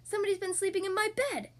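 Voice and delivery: high, fast, mildly roaring papa bear; Daddy Bear roar. A narrator's reading. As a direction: rush it out as an outraged bear. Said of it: "roared Daddy Bear".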